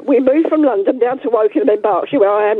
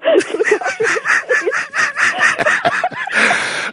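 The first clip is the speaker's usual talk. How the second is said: high cackling laugh; real laugh; 14 semitones higher than usual. Real, not acted. [name] laughing at her son staying home, high cackling laugh. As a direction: burst into high helpless laughter. Caller laughs about school closures.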